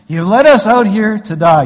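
loud, high mock grumbling; grumbling complaint. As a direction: complain loudly like the disgruntled Israelites. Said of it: "Grumbling and complaining".

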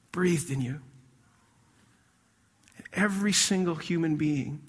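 a hushed, tender sermon about God's love; soft preaching. Real, not acted. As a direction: draw them close and speak gently. Soft intimate preaching passage.

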